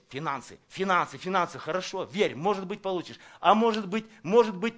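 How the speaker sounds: normal talking voice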